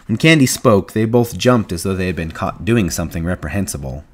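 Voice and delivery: ordinary delivery, nothing marked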